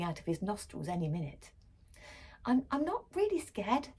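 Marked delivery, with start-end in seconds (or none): 2.4-3.9 higher, stammering protest. A narrator's reading. Little brother defending his sister, higher protest. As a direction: stammer out a brave denial. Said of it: "Randy protested".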